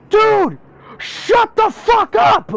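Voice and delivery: high, loud, drawn-out exasperated yell; retold scream at the end of your patience; full-blown scream. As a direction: snap and scream it in their face. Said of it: "full-blown screamed".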